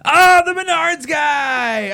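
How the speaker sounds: loud, slow burst of delight; suddenly remembering, thrilled; excited recognition. Real, not acted.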